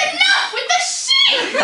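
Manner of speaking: high, drawn-out yell of frustration; explode, completely fed up; exasperated shout. Acted. Goddess to her priest about sheep sacrifices, exasperated shout.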